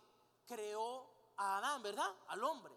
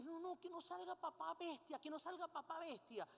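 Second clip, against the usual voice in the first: put-on pleading little girl; 5 semitones higher, 4 dB quieter than usual. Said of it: "viene la niña en ese momento y dice".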